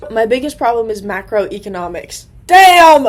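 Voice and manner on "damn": loud curse. Real, not acted. Cursing at her econ class.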